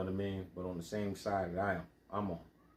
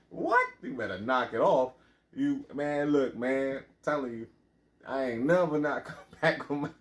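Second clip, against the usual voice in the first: high, loud mock outrage; acted indignant outburst; 4 semitones higher, 6 dB louder than usual. Voicing the projects' reply to someone robbed, mock outrage. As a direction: start on a squealed "What?", then loud, pitched-up scolding. Acts out confronting a robbed friend.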